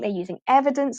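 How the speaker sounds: ordinary talk, nothing marked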